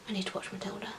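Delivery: very soft, unvoiced whisper; whispered thought. Patient lying awake thinking of a favourite film, whispered thought. Voicing own thought in hospital.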